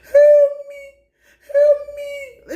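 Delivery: high shrieking cry for help, hammed-up; mocking falsetto screams for help; mock screaming plea. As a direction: shriek it like a begging coward. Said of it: "now he's sitting up here screaming".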